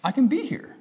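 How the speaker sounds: high, light burst of relief; relieved realization. Retold or imitated. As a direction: let the relief lift the voice up high. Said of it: "my internal world just like".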